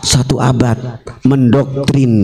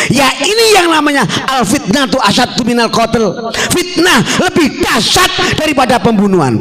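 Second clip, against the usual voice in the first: high, forceful rally oratory; impassioned sermon pitched high; fiery preaching; 11 semitones higher than usual. Real, not acted. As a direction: ring it out to the crowd at full force. Preaches at full force to crowd.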